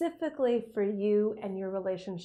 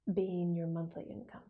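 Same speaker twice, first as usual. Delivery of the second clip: whispering; 4 semitones lower, 6 dB quieter than usual. Acted. Whispered in visualization.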